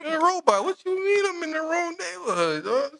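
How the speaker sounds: high, incredulous outrage; can't believe a robot said that; mock outrage. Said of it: "indignant reply to robot".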